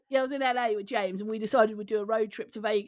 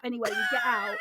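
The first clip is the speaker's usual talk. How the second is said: ordinary talk, nothing marked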